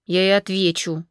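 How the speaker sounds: ordinary delivery, nothing marked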